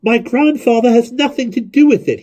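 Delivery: high, loud shout. A narrator's reading. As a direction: shout back at the crowd. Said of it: "he shouted".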